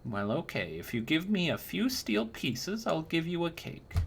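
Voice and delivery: put-on high, sweet old woman voice, fawning; a doting old crone cooing over a young knight; sugary old lady flattering the customer; old crone baker. Old woman sells cakes.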